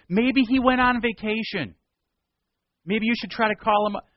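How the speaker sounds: high, loud mocking jeers; sarcastic taunt. Elijah mocks the prophets of Baal with loud sarcasm. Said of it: "You see that sarcasm".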